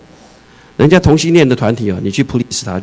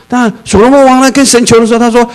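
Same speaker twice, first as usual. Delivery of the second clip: emphatic preaching; 11 semitones higher, 6 dB louder than usual. Real, not acted.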